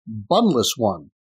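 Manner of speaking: low, slow sandwich voice; deadpan sandwich insult, slowly; talking sandwich voice. Retold or imitated. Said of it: "and the sandwich says".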